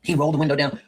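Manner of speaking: usual speaking voice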